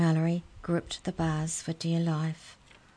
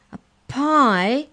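sudden high, loud alarmed squeak; shocked gasp; 8 semitones higher, 9 dB louder than usual. Acted. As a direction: blurt it out in horror. Mouse says they'd become pie.

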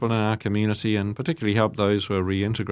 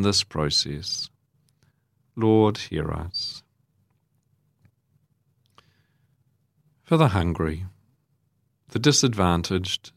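measured liturgical reading with long pauses; formal prayer; 2 semitones higher than usual. Real, not acted. Priest leading the congregation in prayers for others, solemn and measured. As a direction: lead the intercessions in solemn, spaced phrases. Leads the intercessions.

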